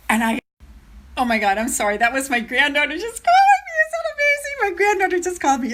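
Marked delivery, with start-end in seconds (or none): 2.0-5.7 real delighted excitement. Real, not acted. Telling viewers her granddaughter called, real excited squeal. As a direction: squeal it higher, thrilled. Granddaughter phones during the live stream.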